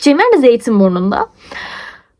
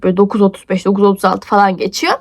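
usual speaking voice

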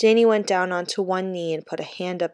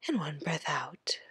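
whispered breath count; 4 semitones lower, 12 dB quieter than usual. A narrator's reading. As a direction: breathe the words out unvoiced. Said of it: "Danny thought".

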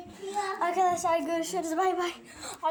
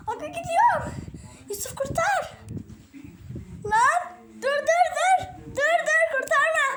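high, loud shrieks of alarm; panicked screaming; 9 semitones higher, 4 dB louder than usual. Real, not acted. Spinning boy shrieking at Yusuf to stop. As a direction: scream as the chair flies round.

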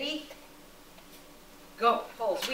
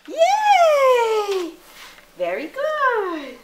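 high, slow gushing dog praise; squeaky praising a dog; 6 semitones higher, 5 dB louder, much slower than usual. Real, not acted. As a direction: gush over the dog with a laugh.

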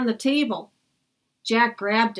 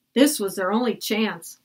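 plain reading voice

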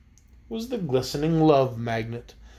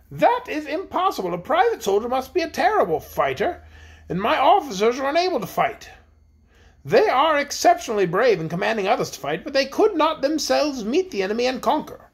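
high-pitched proud queen voice; voiced high-pitched queen, complaining; haughty queen; 9 semitones higher than usual. A narrator's reading. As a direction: play the queen, lofty and put out. Queen Anne's reply.

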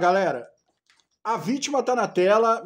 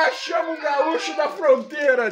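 laughing; 6 semitones higher than usual. Real, not acted. Repeating the joke while laughing.

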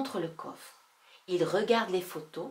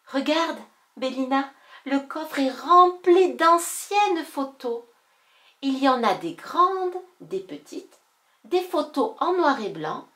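excited discovery; 5 semitones higher, 8 dB louder than usual. Acted. Children opening the old chest, excited. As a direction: marvel at the treasure.

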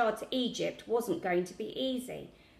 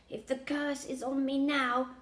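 higher, ailing croak; croaky; 5 semitones higher than usual. A narrator's reading. Sick grandad fearing he is cursed, croaking. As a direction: croak on as if strength is failing. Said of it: "Grandad croaked".

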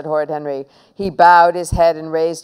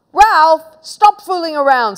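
high, loud order; alarmed calling out; 9 semitones higher, 7 dB louder than usual. Acted. Boy telling hidden friend to stop fooling, loud high call.

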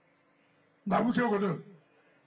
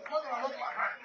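laughing repeat; 4 semitones higher than usual. Real, not acted. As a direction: let it dissolve into a chuckle. Same line, repeated with laugh.

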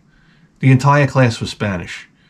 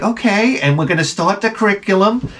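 high, prim teacher voice; 9 semitones higher than usual. Retold or imitated. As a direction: play the patient teacher, pitched up. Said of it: "she's like".

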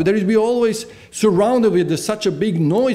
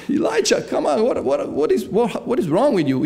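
mock exasperation; 6 semitones higher than usual. Preacher scolds Elijah, mock exasperation.

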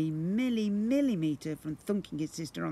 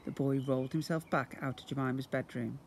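plain reading voice

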